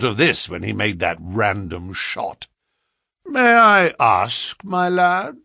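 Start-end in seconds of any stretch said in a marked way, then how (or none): 3.2-5.4 smooth, bland and innocent. A narrator's reading. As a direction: ask it blandly, hiding a trap. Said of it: "I said in the blandest voice".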